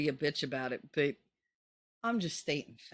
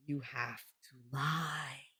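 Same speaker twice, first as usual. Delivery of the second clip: soft, slow and low; hushed; 4 semitones lower, 8 dB quieter, slower than usual. Shown as a demonstration. Confiding to viewers that they must lie, slow and soft. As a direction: drop the voice and draw it out. Repeated advice, second whispered.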